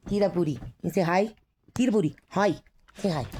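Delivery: high sing-song pet voice; talking to a dog. Real, not acted. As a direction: coo to the dog.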